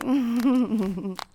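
teasing chuckle. Acted. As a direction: chuckle to yourself, pleased and teasing. Teasing laugh within the roleplay.

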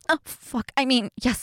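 ordinary talk

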